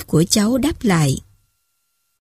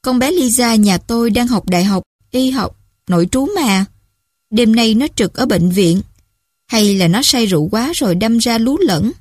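usual speaking voice

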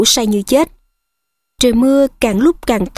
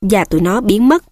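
plain reading voice

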